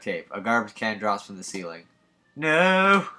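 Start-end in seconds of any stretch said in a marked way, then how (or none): 2.3-3.1 high, loud cry of dismay; loud despairing no; dismayed shout. Acted. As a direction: yell as the trap falls. Garbage can drops on Noelle.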